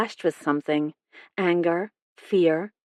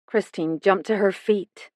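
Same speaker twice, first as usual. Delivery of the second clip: usual speaking voice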